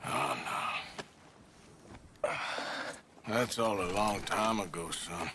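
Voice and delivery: very low, soft brush-off; subdued dismissal. Acted. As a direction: wave it away softly and deep. Subdued answer about old romance.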